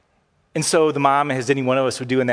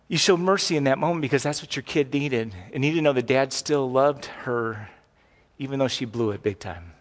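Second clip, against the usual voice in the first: ordinary talk, nothing marked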